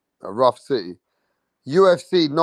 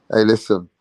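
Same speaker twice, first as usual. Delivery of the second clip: ordinary talk, nothing marked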